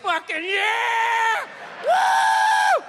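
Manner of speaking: high, drawn-out party yell; drunk cheering. Retold or imitated. Drunk man posing for a photo, high loud whoop.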